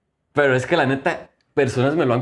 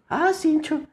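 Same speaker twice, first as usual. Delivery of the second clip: mock sly thought; slower than usual. Mimicking the crier's sly thought.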